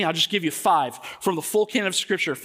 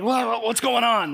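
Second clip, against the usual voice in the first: groggy confusion; 5 semitones higher than usual. Retold or imitated. Groggy dad jolted awake.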